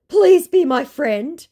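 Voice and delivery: mock the scammer begging; imitating a whiny scammer; whiny mock plea.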